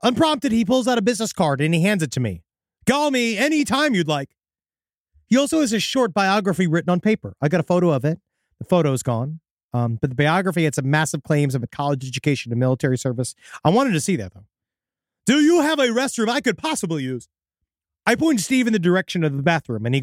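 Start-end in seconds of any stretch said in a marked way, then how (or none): 2.9-4.3 high, unnatural friendliness; weird stilted stranger. Acted. Odd candidate handing a clerk his card, high stilted voice. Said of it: "in a weird accent". 15.3-17.3 high, oddly formal request; weird stilted stranger. Acted. Odd candidate asking the clerk for a restroom, high stilted voice. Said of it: "in a weird accent".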